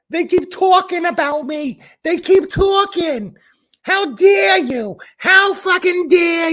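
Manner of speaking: very high, slow, shouted parody; mock outraged rant. Mocking her live rant, building to a high shout. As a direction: ham up her shrill indignant outburst. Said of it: "sorry, it's more like".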